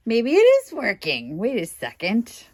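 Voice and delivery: sudden hopeful lift, high and loud; excited surprise. Real, not acted.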